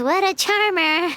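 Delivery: slow, dry sarcastic jab. Acted. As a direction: drawl it out mockingly, unimpressed. Sarcastic jab at rude clerk.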